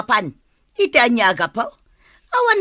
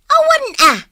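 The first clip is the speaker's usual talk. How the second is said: high, loud denial; angry shout; 13 semitones higher, 4 dB louder than usual. Acted. Angry quarrel between neighbours. (nakapungtot nga ikkis)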